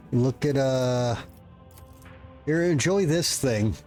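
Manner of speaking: normal talking voice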